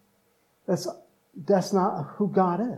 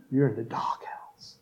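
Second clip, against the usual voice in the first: unvoiced playful whisper; whispered aside; 5 semitones lower, 7 dB quieter than usual. Pastor whispering a joke to the congregation, hushed. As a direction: breathe it like a secret joke.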